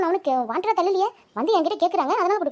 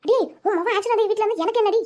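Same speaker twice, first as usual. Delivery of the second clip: angry yelling; 5 semitones higher than usual. Acted. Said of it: "கத்திக்கிட்டு இருக்காங்க".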